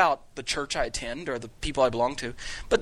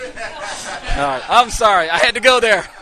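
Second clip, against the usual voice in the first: much louder, laughing through the words; real laughter spilling over the apology; 6 semitones higher, 8 dB louder, slower than usual. Real, not acted. Teacher laughing at his own pun to the class, loud.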